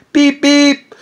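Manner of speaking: high, loud cartoon beep-beep; mock horn beep. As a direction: toot it like a silly bus horn. Mocking drug dealer's bus horn.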